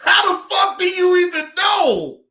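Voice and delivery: high, loud disbelieving yell; loud crying-laugh outburst; incredulous shout. Real, not acted.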